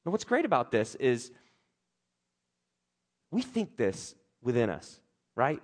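ordinary delivery

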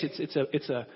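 usual speaking voice

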